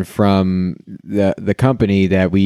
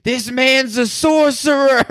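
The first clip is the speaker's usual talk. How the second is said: much higher, slow, hammed-up proclamation; mock medieval patron; 13 semitones higher, slower than usual. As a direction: announce it like a tavern drunk. Drunk patron mock-medieval reply.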